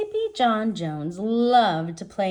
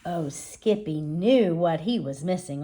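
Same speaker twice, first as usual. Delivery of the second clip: ordinary delivery, nothing marked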